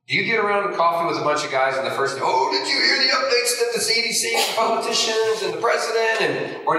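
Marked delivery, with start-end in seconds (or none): 2.2-6.2 high-pitched mock-excited news-sharing; mock gossip. Guys round coffee swapping the latest updates, mock excited. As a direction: put on an eager gossip's higher voice.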